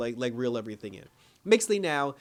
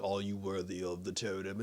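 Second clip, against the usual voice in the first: deep, hushed booming god voice; totem god voice; 7 semitones lower, 8 dB quieter than usual. Retold or imitated. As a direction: intone it like an ancient deity. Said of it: "the totem voice god even said".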